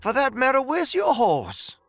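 high, insistent questioning voice; high-voiced man demanding answers; agitated Dooley character. A narrator's reading. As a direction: pile up the questions in a high, rising-heat voice.

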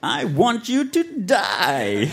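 slow, hammed-up villain voice; undead lord. A narrator's reading. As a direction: draw out the threat like an evil overlord. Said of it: "he said".

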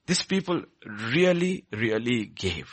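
slow, ringing "really, really gave"; emphatic proclaiming. Shown as a demonstration. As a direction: proclaim it, leaning hard on each "really". Repeated line, first take loud.

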